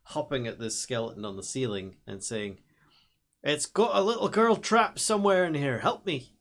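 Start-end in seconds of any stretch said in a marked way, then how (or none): 3.4-6.3 high, loud, theatrical call; mock cry for help. Player acting out a skeleton's plea for help, high mock cry. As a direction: call out loudly in a high put-on voice.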